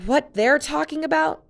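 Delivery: high, loud, fierce retort. Acted. Jen corrects Luke, fierce and loud. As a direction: snap back hard and high. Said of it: "her fierce gaze".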